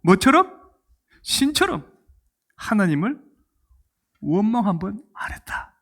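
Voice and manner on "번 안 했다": awed hushed whisper. Real, not acted. Preacher marveling at Paul, whispered. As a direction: breathe it out in awe. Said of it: "awed whispered point".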